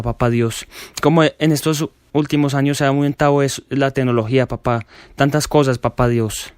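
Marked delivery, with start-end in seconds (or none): none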